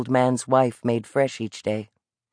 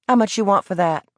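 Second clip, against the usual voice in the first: put-on higher, evasive young boy's voice; defiant Southern boy; 6 semitones higher than usual. A narrator's reading. Boy dodging the vendor, higher and cocky. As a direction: dodge the question with cocky pride.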